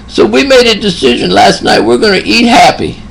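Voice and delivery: high, lightly put-on old man's voice, eat happy said proudly; imitating the patient deciding to eat happy; elderly patient. Old patient quoted by nurse.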